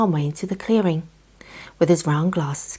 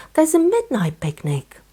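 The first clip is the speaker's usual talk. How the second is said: much higher, slow delighted squeal; thrilled squirrel; 12 semitones higher, 3 dB louder than usual. A narrator's reading. Squirrel hearing of a midnight picnic, thrilled high. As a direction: let the joy lift the voice high.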